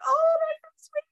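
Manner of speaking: lightly mocked, very high touched squeal; gushing mama impersonation. Host imitates her touched mama, high gushing voice. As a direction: coo it high, as if welling up. Retelling mama's tearful, touched reaction.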